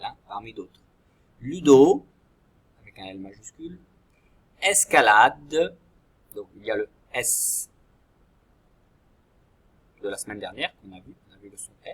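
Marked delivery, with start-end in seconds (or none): 1.4-5.8 dictation voice. Shown as a demonstration. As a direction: dictate 'Ludo' and 'escalade' like a model sentence for the class. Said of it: "je vous donne un modèle". (voix de dictée, mot par mot)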